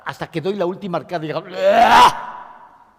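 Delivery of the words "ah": loud forced heaving ah; retching groan. Shown as a demonstration. Teacher acting out the last emotional vomit, loud retching ah.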